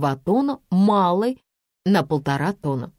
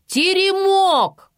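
high, loud, stretched cry; blurted shout; 13 semitones higher, 5 dB louder, much slower than usual. A narrator's reading. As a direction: let the answer burst out. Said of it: "вырвалось у меня".

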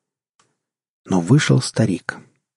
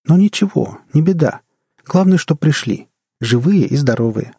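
put-on much higher, louder boyish voice; reassuring old man-child; 6 semitones higher, 4 dB louder than usual. A narrator's reading. Old man-child shrugs off the missed birthday, reassuring high voice.

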